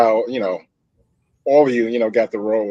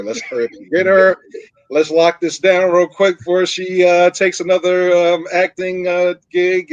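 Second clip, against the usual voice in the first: eager rush; 8 semitones higher, 9 dB louder than usual. Retold or imitated. Recalls eager casting decision.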